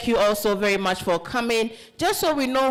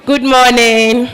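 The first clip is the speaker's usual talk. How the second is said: slow, loud call-and-response greeting; 13 dB louder, slower than usual. Shown as a demonstration. Host leads the room in a loud, slow good morning.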